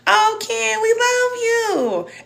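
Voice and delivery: what Ken never heard from people, cooed high and slow; imagined loving friends. Retold or imitated. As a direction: coo it like someone doting on you. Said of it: "I never had people to be like".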